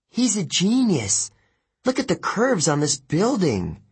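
high-pitched, excited marveling; awed admiration. Acted. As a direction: gush over a genius's work. Annabeth marvels at inventor's sketches.

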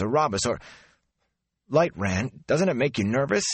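words tumbling out on edge; jumpy. Acted. Mat jumpy, voiced higher.

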